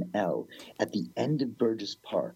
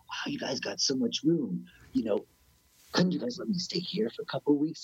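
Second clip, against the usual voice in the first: high-pitched, acted wheedling request; hopeful pleading; 6 semitones higher than usual. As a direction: imitate asking strangers for a big favor. Asking squatters for a place.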